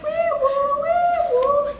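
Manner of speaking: very high sung siren wail; imitated siren. Imitating the store alarm going off, siren wail. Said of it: "this alarm sounds like".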